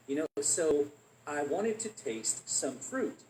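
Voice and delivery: normal talking voice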